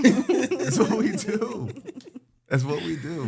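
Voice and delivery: stretched, laughing boast. Real, not acted. Host laughing to co-hosts about their two episodes. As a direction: chuckle it out, pleased with yourself.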